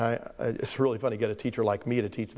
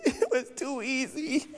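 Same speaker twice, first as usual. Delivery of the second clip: put-on much higher, slow, tearful laughing child voice; a small boy sobbing with relief; crying little boy; 11 semitones higher, slower than usual. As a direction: wail it through tears and laughter.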